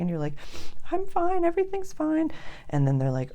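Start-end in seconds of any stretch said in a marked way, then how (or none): 0.9-2.3 much higher and louder, squeaky forced cheer; forced fine. Retold or imitated. Answering the neighbour at the door, much higher, forced fine. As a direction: fake it's-all-fine brightness.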